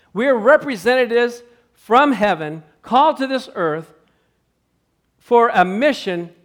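slow, loud, high pulpit proclamation; preaching at full force. Acted. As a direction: proclaim it slowly. Preaching climax.

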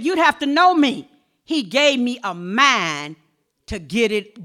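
ordinary talk

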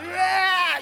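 loud, high mock scream of effort; acted straining yell. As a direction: yell as if prying off a clinging child. Mimes prying clinging child off.

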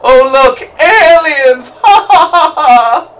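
loud, high mock excitement; excited shout. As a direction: shout it like a cheesy B-movie. Acts out movie scene.

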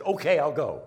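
a loud, resigned okay; giving in loudly. Retold or imitated. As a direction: blurt it out, done resisting. Finally gives in to wife.

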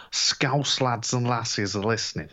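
slow, jokey Liverpool Scouse accent put on for the listeners. A Liverpool fan greets Scouse listeners in a mock Scouse accent. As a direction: have a go at a broad Scouse accent, slowly. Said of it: "that was me best boss accent".

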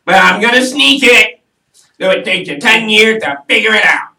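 high, loud made-up politician voice; Donald Trump. Acted. Guest answers host's impression with his own, high loud caricature. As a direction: push it up into a loud cartoon politician. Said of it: "make up my own voice called Donald Trump".

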